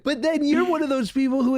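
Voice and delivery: laughing tease. Real, not acted. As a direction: tease him while still laughing. Teasing while laughing.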